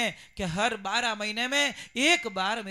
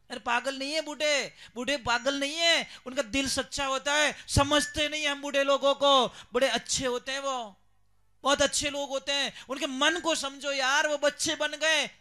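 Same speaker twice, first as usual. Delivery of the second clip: raised, emphatic preaching voice; fervent sermon voice, a bit louder; 4 semitones higher, 5 dB louder than usual. Acted. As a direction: plead with the congregation to understand the elderly. Preacher addresses audience directly.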